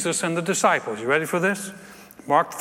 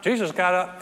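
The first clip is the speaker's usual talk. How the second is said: ordinary delivery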